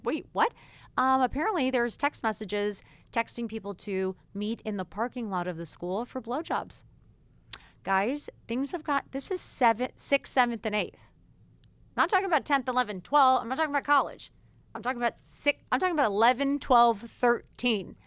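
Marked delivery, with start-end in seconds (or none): 0.0-0.5 shocked disbelief. Retold or imitated. Retold shock at threesome story. 11.9-17.9 raised, insistent voice, louder and higher; alarmed emphasis. Real, not acted. Alarmed about kids' ages.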